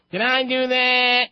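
put-on high nasal nerdy kid voice; nasally Steve Urkel. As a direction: squeak it out like a nerdy sitcom kid. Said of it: "in that nasally voice".

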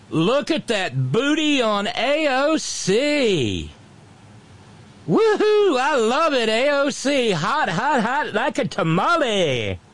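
high, drawn-out leering whoop; yelled catcall. Retold or imitated. Imitating a heckler catcalling a congresswoman, high mock yell. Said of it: "he yelled".

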